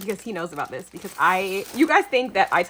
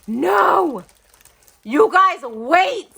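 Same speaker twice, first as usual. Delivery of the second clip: gasp, then loud high exclamations; loud, higher, astonished outburst; shocked disbelief; 8 semitones higher, 9 dB louder, much slower than usual. Real, not acted. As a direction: cry out in stunned delight. Disbelief at the gift.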